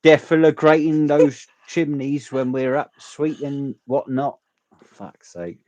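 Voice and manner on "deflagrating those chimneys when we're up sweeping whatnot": loud, high chimney-sweep Cockney; hammed-up London accent; British accent. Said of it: "Right, Angela?".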